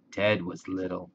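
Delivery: plain reading voice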